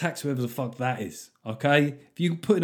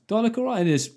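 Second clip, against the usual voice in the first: higher-pitched mock wife voice; imitated wife; 9 semitones higher, faster than usual. Wife asking husband about her new jeans, imitated in a high voice. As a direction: lift the voice high for a wife's question.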